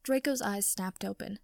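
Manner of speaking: ordinary delivery, nothing marked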